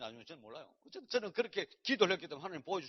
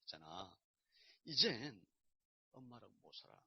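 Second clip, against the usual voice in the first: quiet hard news; 7 semitones lower, 6 dB quieter than usual. Retold or imitated. Father tells son hard news.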